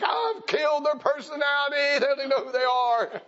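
high, loud hammed-up sobbing lament; mock crying. Mom crying over her child, high loud mock wail. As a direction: overdo the guilty parent's wail. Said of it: "you're over there crying like".

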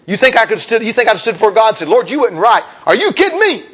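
high incredulous protest. Retold or imitated. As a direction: rise in disbelief at the very idea.

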